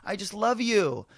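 high, loud gushing praise; gushing fan. Retold or imitated. Zoe Saldana gushing to the comic after the show, high and loud. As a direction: mimic a star gushing over you. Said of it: "she grabbed me and she goes".

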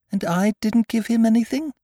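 put-on high child's voice, plaintive question; sad. A narrator's reading. Christopher Robin asking about his forgotten gift, sad. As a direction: ask it plaintively, pitched high. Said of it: "asked Christopher Robin sadly".

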